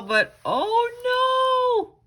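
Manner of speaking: high, slow dramatic oh no; mock alarm. Reading to kids, teacher gasps at the hiccups in mock alarm. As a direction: stretch it out in storybook dismay. Dramatic moment in read-aloud.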